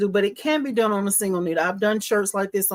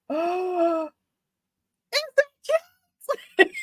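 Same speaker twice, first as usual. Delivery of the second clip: high, gasping delight; 11 semitones higher, slower than usual. Real, not acted. Embroiderer gasping to viewers at the design. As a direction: gasp and coo. Gushing over cute embroidery.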